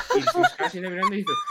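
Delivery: high, loud, fast words breaking into laughter; can barely get it out for laughing; laughing narration. Real, not acted. Telling friends about the wrong door, cracking up loudly. Laughing through his own story.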